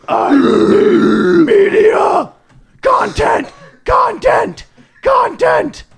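very high, slow, shouted craving; screen-addicted kid. Retold or imitated. S0 mocking kids craving content, high drawn-out wail.

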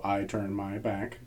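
slow, soft, solemn line reading; slow recital. Pretend. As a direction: read it like a grave poem, very slowly. Slow mock haiku recital.

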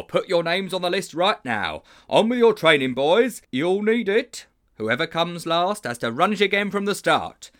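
high, loud rallying bellow; booming, gleeful drill commands; overbearing games teacher. Acted. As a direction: order the boys into training at full voice. Stupendo voiced in story.

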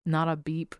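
ordinary delivery

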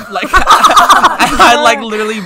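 high, loud, talking through laughter; laughing hard. Real, not acted. Friend confessing an ear bite to friends, laughing hard. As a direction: crack up while confessing it. Cracking up over the bitten ear.

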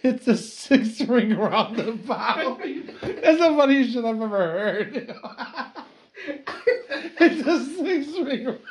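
high, slow words through laughter; laughing. Real, not acted. Friend can't stop laughing at the six ring roundabout, high and slow. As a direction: crack up while saying it.

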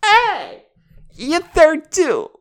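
high, loud holler; calling out. Pretend. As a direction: shout it out high, drawing out the words. Mock calling out to someone.

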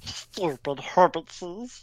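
slow, hissing creature voice, far from his own; Gollum voice. Acted. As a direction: drag it out like Gollum spitting the words. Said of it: "Lord of the Rings, the thing, Gollum".

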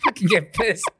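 very high, giggling fake anger; mock threat while laughing. Dad joking about a rival toddler, laughing high. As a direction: pretend to fume but keep cracking up. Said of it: "Just kidding".

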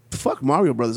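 ordinary talk, nothing marked

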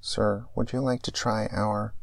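crackly drive-thru speaker voice; like it's coming through a tinny box; mechanical speaker voice. Acted. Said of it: "A mechanical voice crackles".

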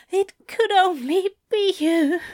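high, adoring moan. A narrator's reading. Aziraphale to lover, moaning devotion. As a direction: keep it high and rapturous. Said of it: "Aziraphale is moaning".

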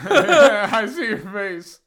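loud, slow laughter through words; laughing hard. Real, not acted. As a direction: crack up while talking. Laughing at friend's spicy face.

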